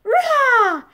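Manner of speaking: high, loud all-out rah; desperate yell. Acted. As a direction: yell till your breath gives out. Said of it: "Joel yelled so desperately".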